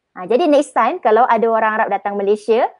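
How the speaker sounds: normal talking voice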